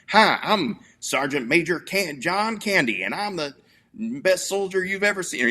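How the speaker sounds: mock country-bumpkin accent, pitched well up; hokey hayseed accent.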